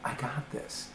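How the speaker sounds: hushed, whispered cool confidence; chilled girl. Acted. Coach models the calm self-talk for client, chilled whisper. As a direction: whisper it like someone totally chilled out. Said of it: "chilled girl saying".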